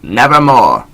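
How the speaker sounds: one dark word from the bird; raven's croak. Acted. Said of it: "Quoth the raven".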